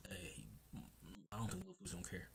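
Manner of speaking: ordinary talk, nothing marked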